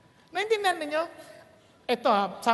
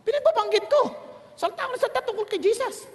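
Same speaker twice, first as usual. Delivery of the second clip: high, loud, fast and thrilled; triumphant; 9 semitones higher, 4 dB louder, much faster than usual. Real, not acted. Preacher telling the crowd he preached Jesus anyway, triumphant. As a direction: burst out in joy.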